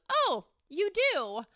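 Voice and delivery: a light lift of mom's surprise; gentle surprised mother. Retold or imitated. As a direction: mom caught off guard, lightly voiced. Mom answers the school's call.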